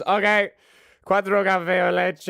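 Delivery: high, loud call of the order; cheerful order call. Acted. Swan Dos confirming four coffees, high and loud. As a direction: sing out the order like a proud barista. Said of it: "he goes".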